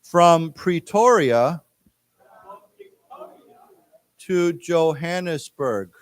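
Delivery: slow, syllable by syllable, with long pauses; slow, clear teacher reading, phrase by phrase; slow read-along. Shown as a demonstration. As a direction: read it out slowly for the class to repeat. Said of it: "let's say this again".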